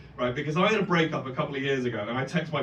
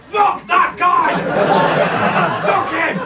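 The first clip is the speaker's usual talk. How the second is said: higher, louder outraged yell of a best friend; furious shout; 11 semitones higher, 6 dB louder, slower than usual. Retold or imitated. Best friend hearing of the breakup, furious shout. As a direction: bellow it like a fierce loyal friend.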